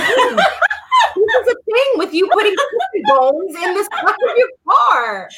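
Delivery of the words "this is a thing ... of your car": high, laughing tease about the chicken bones. Real, not acted. Host teasing her cohost about chicken bones, laughing.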